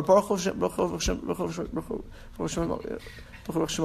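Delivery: Baruch Hashem gabbled fast, fading to a mumble; rushed mumbling. Shown as a demonstration. Showing the shul how rushed blessings sound, fast fading mumble. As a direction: rattle off "Baruch Hashem" over and over, swallowing the words. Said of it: "Uh, you can't say it".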